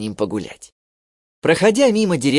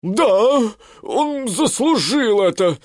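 grumpy elephant; 7 semitones higher, slower than usual. Acted. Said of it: "сказал один из слонов".

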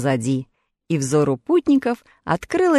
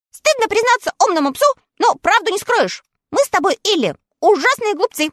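high, quick small dog; much higher quick puppy voice; little dog voice; 13 semitones higher than usual. A narrator's reading. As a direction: chatter like an eager little dog. Little dog Totoshka speaks.

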